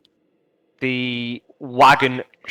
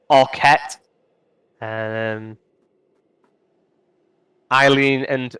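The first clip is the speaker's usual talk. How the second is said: usual speaking voice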